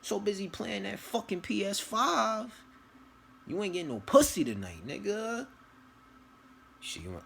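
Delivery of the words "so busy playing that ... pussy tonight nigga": high-pitched furious mock scolding; angry wife. Wife yells at husband over the burnt chicken, high mock fury. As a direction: screech it like a fed-up wife. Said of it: "she mad at you. She pissed".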